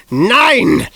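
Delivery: loud high bellow; woman bellowing a warning. A narrator's reading. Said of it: "Missus Lysander bellowed".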